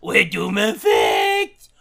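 loud, high excited greeting; hyped podcast intro. Acted. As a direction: shout the show intro with energy.

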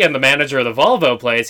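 ordinary delivery

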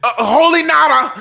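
high, mocking sing-song fan voice; hopeful fan, hammed up high. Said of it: "they come in year after year saying".

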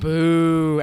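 long, drawn-out "Boo"; emphatic boo. Real, not acted. Co-host jeers the movie pick just announced, emphatic boo. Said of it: "Emphasis on boo".

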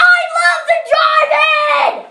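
high, sustained excited shout; boy's gleeful wild yell; wild scream. A narrator's reading. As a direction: scream it wildly, held way up high. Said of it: "Hayden screamed wildly".

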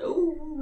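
high little howl; puppy howl. Retold or imitated. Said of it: "his little howl's like".

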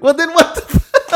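high, loud exasperated laugh-yell; disbelieving yelp through laughter; laughing outrage. Retold or imitated. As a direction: retell the outrage cracking up. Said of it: "I was like".

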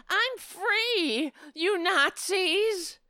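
high, slow mock cry of freedom; mocking her again. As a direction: squeal it like the smug shopper. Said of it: "going around".

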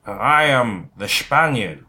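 put-on slow Scottish accent with slushy S; drawn-out mock Scottish accent; Sean Connery impression. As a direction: say it slowly in a hammed-up Scottish accent. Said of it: "Sean Connery".

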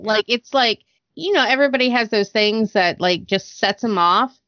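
ordinary delivery

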